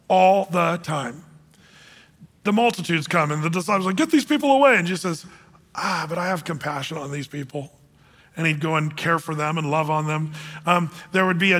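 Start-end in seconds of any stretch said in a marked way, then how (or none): 4.0-4.8 higher, louder, shooing the crowd off; a mock brush-off to the crowds; impatient disciples. 5.8-7.7 softer and lower, tenderly answering; calm reassurance over the disciples' fuss; gentle Jesus. Retold or imitated.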